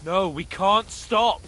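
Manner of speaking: loud, slow desperate plea. Acted. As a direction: refuse to let him stop. Dragging his friend onward.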